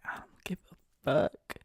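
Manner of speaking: slow breathless whisper, I don't give a fuck; a hushed, slowed retort; whispered brush-off. Retold or imitated. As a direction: shrug it off in a whisper. Retold dismissive reply.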